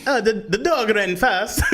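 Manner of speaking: high, clumsy try at an English accent; bad English accent. Shown as a demonstration. Showing off his English to co-host, bad English accent. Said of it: "Like, that's my English".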